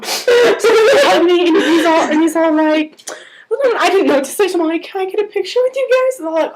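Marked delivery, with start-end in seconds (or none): 4.8-6.2 high, quick little plea; nervously eager retold question; timid fan request. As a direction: ask it fast and pitched up. Retold shy request to the band.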